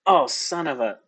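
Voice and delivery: a touch of a frustrated curse, cut short; exasperated outburst. Acted. Elias as he remembers the straggler, exasperated outburst. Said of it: "and he goes".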